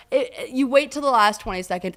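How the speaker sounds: usual speaking voice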